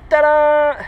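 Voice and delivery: loud, high triumphant reveal; triumphant tadam. Real, not acted. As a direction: announce the find with a flourish. Finds hidden cache.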